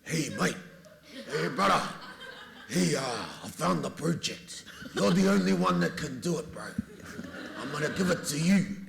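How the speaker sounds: high, loud matey hail; Temuera Morrison. Acted. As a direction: call out to your bro with the big news. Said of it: "Cliff got given the script by Temuera Morrison".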